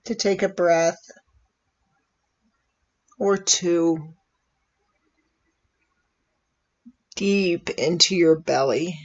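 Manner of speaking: calm, unhurried meditation voice with long pauses; soothing, measured invitation to breathe and settle; guided meditation. Real, not acted. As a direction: gently guide listeners into relaxing, leaving space after each phrase.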